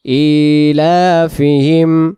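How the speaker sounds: slow drawn-out tajweed recitation; measured Quranic chant. Shown as a demonstration. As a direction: recite each word slowly and carefully. Recitation of the surah.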